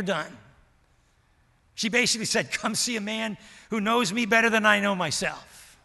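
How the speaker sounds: loud, driving pulpit voice; full-force preaching. Acted. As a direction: preach it out to the whole church.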